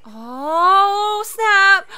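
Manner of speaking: long, swelling cry of surprise; stunned exclamation. Real, not acted. Reacting to a sudden twist.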